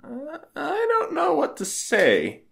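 high, slow, halting bewilderment; lost for words after her confession; stunned. Acted. Groom stunned by vow revelation.

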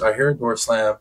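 ordinary talk, nothing marked

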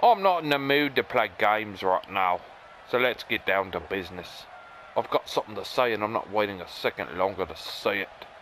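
ordinary talk

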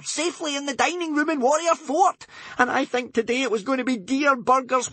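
put-on high, fretful boy voice; flustered. A narrator's reading. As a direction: flap about in a fluster. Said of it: "flapped Bodkin".

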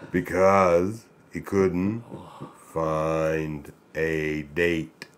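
very slow, stretched-out punchline; long drawn words with gaps; slow motion. Acted. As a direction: drag every word out in slow motion. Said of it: "Read the joke in slow motion".

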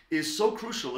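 usual speaking voice

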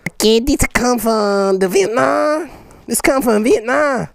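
slow, hammed-up Vietnamese accent; mock Vietnamese accent. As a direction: read the label in a jokey Vietnamese accent. Mock foreign accent reading package label.

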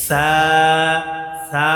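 সা as a light Arabic "thaa" with a long vowel; thin, stretched. Shown as a demonstration. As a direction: lengthen the "aa" of সা, keeping it thin. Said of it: "পাতলা করে উচ্চারণ হবে".

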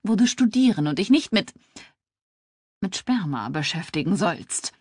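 flustered outburst that falters mid-sentence; upset. A narrator's reading. Mom scolds college daughter, flustered and upset. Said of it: "Ihre Mom war so aufgebracht".

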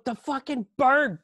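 high, loud panicked babble; losing it. Retold or imitated. Man tells fiancée a rooster attacked him, hysterical. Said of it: "And I'm just losing it".

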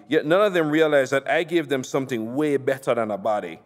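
usual speaking voice

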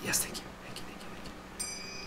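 relieved breathy whisper; whispered thanks. Real, not acted. Relieved after making jump.

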